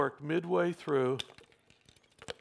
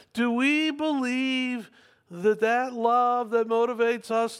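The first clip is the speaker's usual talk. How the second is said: raised, higher rhetorical questioning; impassioned preaching; 5 semitones higher than usual. Real, not acted. Preacher asking congregation if we believe, impassioned challenge. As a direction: press each question on the congregation.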